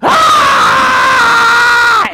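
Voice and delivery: very high loud held scream; imitated toddler scream. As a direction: wail right into his ear. Brother screaming in the car.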